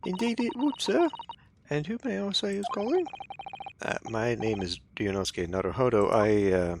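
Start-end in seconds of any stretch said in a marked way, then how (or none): 0.1-3.1 high, polite maid voice; English maid voice. Acted. Maid asking visitors who is calling, high voice. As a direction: pitch well up and keep it proper. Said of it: "That was a real-life English maid".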